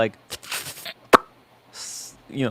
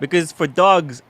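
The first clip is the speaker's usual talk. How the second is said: usual speaking voice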